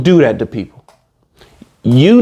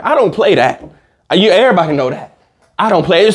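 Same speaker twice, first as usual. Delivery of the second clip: ordinary delivery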